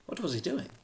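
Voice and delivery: plain reading voice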